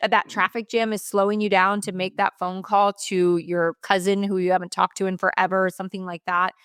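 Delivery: ordinary talk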